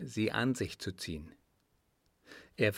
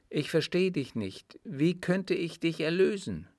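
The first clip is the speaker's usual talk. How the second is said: higher-pitched female character, puzzled; lightly voiced woman; 7 semitones higher than usual. A narrator's reading. A young woman questioning her suitor, puzzled.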